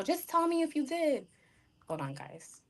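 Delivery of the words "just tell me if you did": lightly voiced mother, pitched high; a hint of a mother pressing her daughter; mother pressing daughter about sex, high mock-insistent; pushy mom. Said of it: "And she's yelling at me".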